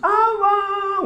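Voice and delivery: "Abang" cried out high as a mock wail; a retold widow's cry, high and drawn; imitated wail. Widow wailing at funeral.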